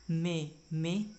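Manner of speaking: में, में drawn out slowly to let the vowel be heard; slow में, में to bring out the vowel; sounding out the syllable. Shown as a demonstration. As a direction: stretch में, में so the class hears the sound. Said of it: "में का तो यहाँ पे माँ का साउंड आ रहा है".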